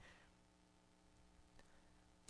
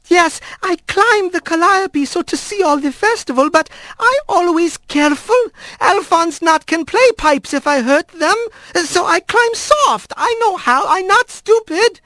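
higher, careful, broken phrasing; halting rat voice. Acted. Rat flattering his grandmother, higher and halting. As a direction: flatter her with effort over each word.

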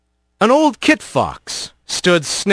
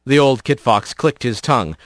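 ordinary delivery, nothing marked

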